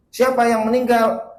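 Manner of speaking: loud, high-pitched demand; exasperated questioning. Acted. Ustadz asking crying woman who died, exasperated. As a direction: ask it loudly, pushing for an answer. Acted ustadz presses for answer.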